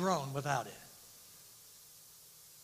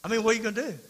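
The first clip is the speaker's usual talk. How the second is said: ordinary talk, nothing marked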